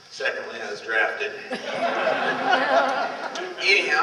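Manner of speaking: ordinary talk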